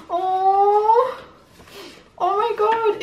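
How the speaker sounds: high, loud delighted gasp of surprise; oh, a real gasp, voice leaping up; surprised gasp. Real, not acted. Unboxing a gift, realising what it is: surprised cry.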